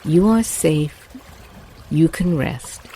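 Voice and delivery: ordinary delivery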